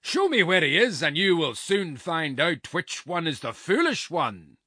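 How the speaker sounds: high, loud, threatening roar; giant's roar. A narrator's reading. Giant demanding to face his rival, roaring threats. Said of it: "roared the giant".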